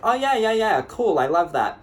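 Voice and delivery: high, bright fake enthusiasm; fake cheerful. To the host, a forced cheery reply. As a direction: pretend to love the dinner plan.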